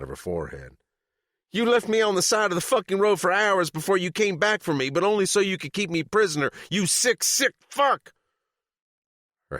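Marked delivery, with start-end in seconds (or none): 1.5-8.1 high, loud rant, rushing then spitting the end; raging. Acted. Captive woman curses Chop for leaving her, high furious rant. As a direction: let the fury pour out, building to sick fuck.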